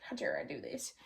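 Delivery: low, whispery aside; hushed self-scolding. Pretend. Chiding herself for joking, hushed mock reproach.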